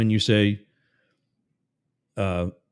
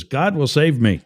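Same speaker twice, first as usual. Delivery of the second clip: raised, loud, confident call; yelling back; 5 semitones higher, 5 dB louder than usual. Acted. Stranded man waving off the Coast Guard, yelling back. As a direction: holler it upward, higher and louder. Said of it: "Yells back up".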